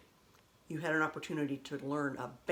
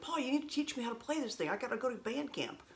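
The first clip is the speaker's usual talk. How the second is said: put-on higher, fast kid's plea; pleading with brother; 7 semitones higher, 4 dB quieter than usual. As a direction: mimic your younger self nagging, pitched up. Begging older brother for lessons.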